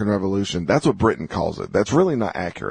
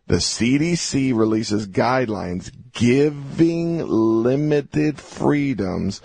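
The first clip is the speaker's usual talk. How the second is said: very slow, higher-pitched reread, leaning on limited freedoms; slow emphatic reading; 4 semitones higher, much slower than usual. Shown as a demonstration. Host rereads the headline a third time, slow and pointed. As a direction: drag out the phrase to mock it. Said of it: "let me read this again slowly".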